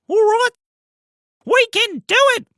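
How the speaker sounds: squeaky high cheer; rally them loud and very high. Acted. Tiger Power rallying team.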